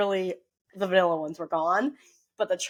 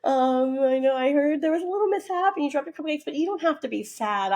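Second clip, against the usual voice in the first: put-on gentle voice for a kid; 10 semitones higher, 3 dB louder than usual. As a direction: coax a sad little boy kindly. Comforting the crying birthday kid.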